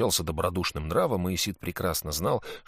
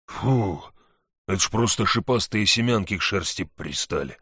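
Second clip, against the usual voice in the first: lightly voiced big hunter; a hint of a relieved big man; hunter's voice; 4 dB louder than usual. Narrator voices the hunter Valu.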